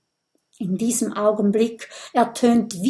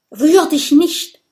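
urgent warning cry, higher and louder; sharp raised-voice warning; calling out; 7 semitones higher, 8 dB louder than usual. A narrator's reading. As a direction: call out to stop a friend dead. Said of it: "ruft Kokosnuss".

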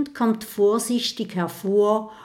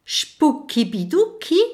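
higher, questioning echo rising at the end; puzzled repeat; 8 semitones higher, 5 dB louder than usual. Acted. Little dragon puzzled, repeating the ghost's strange words. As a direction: repeat the odd words, baffled. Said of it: "wiederholt Kokosnuss".

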